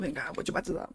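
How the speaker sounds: the pastor calling him softly to be baptised; pastor's soft call. Retold or imitated. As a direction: quote the pastor quietly. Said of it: "aí meu pastor falou".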